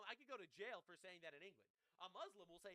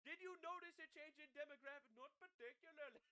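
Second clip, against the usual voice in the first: mocking Indian accent, a touch higher; 5 semitones higher than usual. Streamer replaying the street interview in a mocking Indian accent. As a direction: redo the interview line in a put-on Indian accent. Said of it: "I just give Indian accents now".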